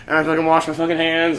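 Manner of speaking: ordinary talk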